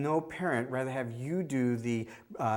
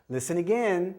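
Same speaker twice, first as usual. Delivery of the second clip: higher, brighter listen again; bright coaxing cue; 6 semitones higher, 3 dB louder than usual. Shown as a demonstration. Prompting a child at the screening, bright coaxing. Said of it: "I go".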